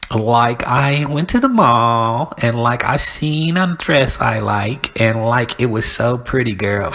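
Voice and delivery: mock a mall-girl gushing.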